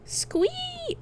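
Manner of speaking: high piercing pig squeal; shrill squeak of a man playing a pig. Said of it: "I don't understand your oinking".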